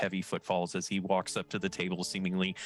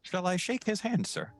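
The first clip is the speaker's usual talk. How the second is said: a lightly voiced robot, higher and clipped; strange metallic voice; 11 semitones higher than usual. Audacio the robot greeting the party, lightly robotic. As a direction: speak as a calm machine man.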